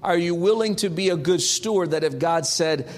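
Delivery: usual speaking voice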